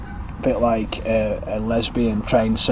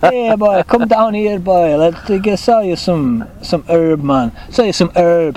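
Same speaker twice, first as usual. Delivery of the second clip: lightly higher mock Jamaican dealer; hammed-up Jamaican street seller calling out; mock Jamaican accent; 5 semitones higher than usual. Host imitates Jamaican dealer calling to buyer, mock Jamaican accent. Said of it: "slips into a bit of Jamaican".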